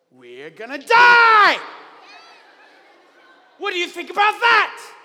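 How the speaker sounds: hammed-up terror at the crew; panicked sailors. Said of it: "They're like".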